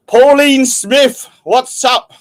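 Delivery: drawn-out, high, loud greeting; loud shout-out. Real, not acted. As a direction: call the name out long and loud. Greeting viewers by name on stream.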